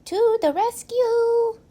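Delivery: put-on high, slow child's hero call; a little boy playing superhero; playful hero cry. A narrator's reading. Owen playing Captain Plunger, a high slow heroic cry.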